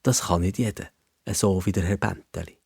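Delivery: usual speaking voice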